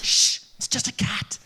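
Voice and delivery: slow urgent whisper. Acted. Boy leader calming friends after the cat, whispering. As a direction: hush the scared boys, voiceless. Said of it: "They whisper as they speak".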